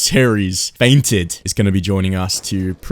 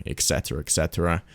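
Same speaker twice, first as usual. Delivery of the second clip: much lower, softer throwaway; low mumble; 4 semitones lower, 9 dB quieter, faster than usual. Real, not acted. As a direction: mumble it off dismissively. Trailing dismissive.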